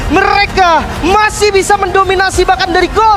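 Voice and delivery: high, loud victory call; hype shout. Real, not acted. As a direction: shout the comeback with joy. Co-caster celebrates the win.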